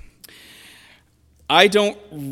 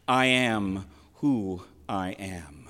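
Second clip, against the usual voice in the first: solemn divine quote; 8 semitones lower, 3 dB quieter, slower than usual. Acted. Quoting God's name to Moses.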